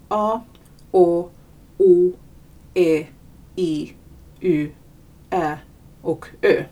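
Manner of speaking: each vowel held alone, evenly spaced; Finnish vowel names. Shown as a demonstration. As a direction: recite the Finnish vowels one by one. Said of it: "läser man upp på finska så här".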